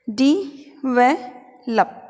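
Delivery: डी व लप broken into separate syllables. Shown as a demonstration. Teacher splits डेवलप into syllables for students. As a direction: break डेवलप into डी, व, लप. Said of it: "देखते हैं क्या सही है".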